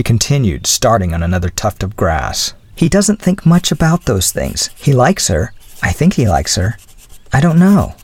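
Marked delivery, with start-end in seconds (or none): 2.7-7.9 put-on higher-pitched girlish voice, explaining eagerly; lightly voiced young woman making excuses; flustered young woman. A narrator's reading. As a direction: lift the voice for a flustered girl.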